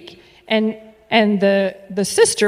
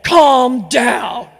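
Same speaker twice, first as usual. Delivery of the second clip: firm calm down; 2 semitones higher, 8 dB louder than usual. Retold or imitated. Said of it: "grabbed her by the shoulders and said".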